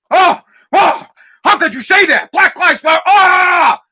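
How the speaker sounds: loud high mocking offended voice; mock outrage. Mocking outraged fans yelling at Drew Brees. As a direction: ham up the offended crowd.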